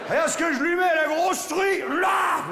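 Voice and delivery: very high, hammed-up triumphant yell; vengeful triumph. Male spider avenging his dead pal Maurice, triumphant shout. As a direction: cry victory up to your fallen mate. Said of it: "il se souvient de son pote Maurice".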